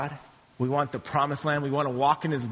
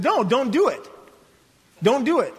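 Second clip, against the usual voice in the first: acted plea, pitched up; higher-voiced, insistent warning; urgent warning; 8 semitones higher, 5 dB louder than usual. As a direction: plead with them to stop. Moses urgently warns the people.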